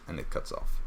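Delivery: ordinary talk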